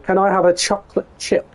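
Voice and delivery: ordinary talk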